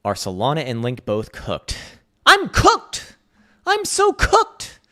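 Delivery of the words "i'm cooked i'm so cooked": much higher, loud hammed-up whine; mock wail. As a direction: whimper in fake despair, dragging it out. Mock despair at coin crash.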